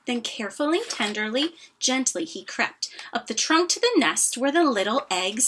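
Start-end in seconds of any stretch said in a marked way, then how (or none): none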